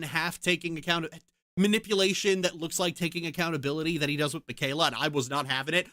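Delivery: ordinary talk, nothing marked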